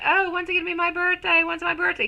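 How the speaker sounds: put-on high, eager child voice, lightly done; imitating her young son. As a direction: mimic an impatient little boy asking. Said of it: "my son who keeps telling me".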